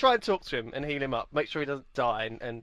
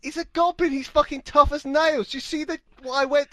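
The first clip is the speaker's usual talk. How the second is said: defensive protest; 13 semitones higher, 6 dB louder, faster than usual. Real, not acted. Player defends loudly.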